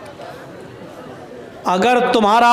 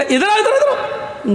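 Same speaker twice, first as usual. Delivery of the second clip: high beckoning call; calling back; 13 semitones higher than usual. Retold or imitated. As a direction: wave him back over, calling high. (اونچی آواز میں بلانا)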